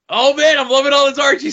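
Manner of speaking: playfully mimicked enthusiasm; mock gushing. Mimics co-host's excited gushing.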